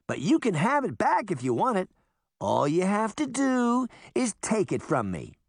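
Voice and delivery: high, brisker goading call; bold taunting. A narrator's reading. Sabrina showing the boy how to bait horseman, goading taunt. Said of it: "Like this".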